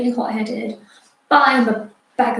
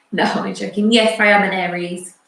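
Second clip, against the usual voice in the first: ordinary delivery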